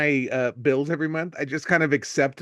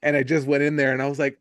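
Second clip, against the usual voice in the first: ordinary delivery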